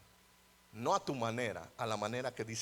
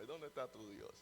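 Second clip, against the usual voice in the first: mocking; 13 dB quieter than usual. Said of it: "En forma de burla".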